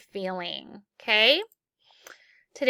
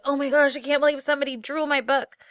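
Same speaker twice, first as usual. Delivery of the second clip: higher, quick burst of disbelief; mock upset reaction; 5 semitones higher, 3 dB louder, faster than usual. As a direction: act out a rushed, indignant first reaction. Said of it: "your initial reaction might be like".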